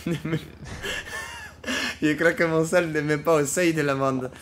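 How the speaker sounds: giggling through the excuse; laughing. Real, not acted. Fares laughing as he tells the cop he can't pay the fine. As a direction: try to talk while cracking up.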